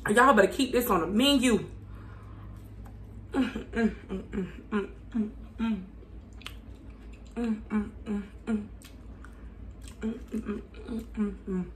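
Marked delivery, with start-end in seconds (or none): 3.3-11.6 soft rhythmic food humming; contented humming. Real, not acted. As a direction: hum quietly to yourself while chewing. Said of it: "something good and you start humming".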